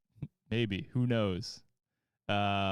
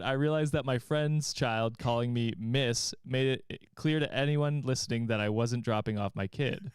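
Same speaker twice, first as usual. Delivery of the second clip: normal talking voice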